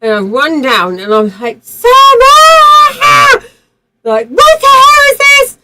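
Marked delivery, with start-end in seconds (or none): 1.6-3.4 piercing, high name breaking into a scream; retold screaming. Running down from the bus stop, retold full-voiced screaming. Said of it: "So I started screaming". 4.3-5.6 very high, loud shrieked question; retold panicked shriek. Screaming on seeing the accident, retold panicked shriek.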